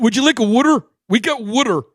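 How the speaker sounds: high, loud mock Pennsylvania accent. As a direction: tease with a broad Pennsylvania "wooder" for "water". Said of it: "He's a Pennsylvania kid".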